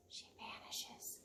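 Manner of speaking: slow breathy whisper; whispered vanish. A narrator's reading. Reading to schoolkids, a slow vanishing whisper. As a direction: fade the words away to nothing.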